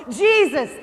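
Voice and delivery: a blind beggar hollering for Jesus, full voice. Acted. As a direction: shout each name over a crowd, straining to be heard. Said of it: "he just hollered all the more".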